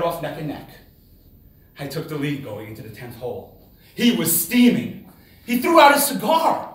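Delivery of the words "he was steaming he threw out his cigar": much higher and louder, building; dramatic buildup; rising tension. Real, not acted. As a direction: pump up the drama. Opponent furious, tension rising.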